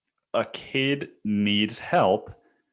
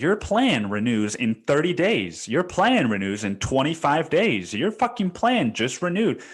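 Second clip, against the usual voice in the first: mocking text message; 7 semitones higher, 4 dB louder than usual. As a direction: parody the nagging carrier text, each one higher. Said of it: "and says".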